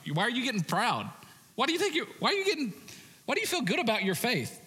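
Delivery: Paul scolding. Retold or imitated. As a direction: needle them for getting proud. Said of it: "He's saying".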